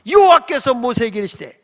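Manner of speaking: ordinary talk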